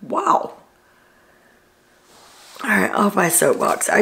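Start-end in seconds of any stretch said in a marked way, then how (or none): 0.0-0.6 mock wow. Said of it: "I'm like".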